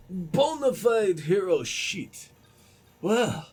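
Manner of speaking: slow, heavy Russian accent on 'Bona fide hero shit'. Shown as a demonstration. Gamer repeats the line for viewers in a Russian accent. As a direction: drag it out in a thick Russian accent. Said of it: "except in a Russian accent".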